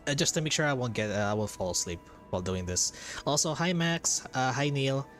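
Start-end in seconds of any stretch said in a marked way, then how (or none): none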